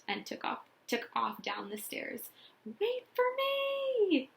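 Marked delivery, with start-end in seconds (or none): 2.7-4.3 high slow frightened yell. A narrator's reading. Kitty yells at Kent as he runs downstairs in fright. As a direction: call out after a fleeing brother. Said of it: "Kitty yelled".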